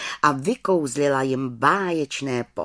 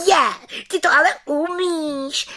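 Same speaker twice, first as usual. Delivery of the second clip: high, louder joyful cry; children delighted; 5 dB louder than usual. Acted. Children delight in the witch's tricks, high voice. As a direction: cheer like thrilled children. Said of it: "radovaly se děti".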